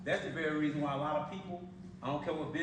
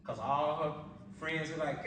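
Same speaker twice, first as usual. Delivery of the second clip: normal talking voice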